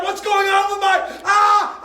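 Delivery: high, loud panicked shout; panicked cry. Retold or imitated. King seeing his withered hand, panicking loudly.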